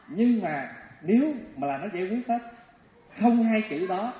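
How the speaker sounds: normal talking voice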